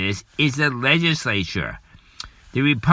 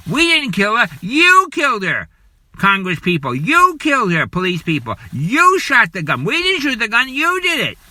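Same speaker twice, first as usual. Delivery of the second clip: rising fury, voice pitched up high; angry accusation; 6 semitones higher than usual. Real, not acted. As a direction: hurl the blame at Congress and police. Accusing Congress and police of the shooting.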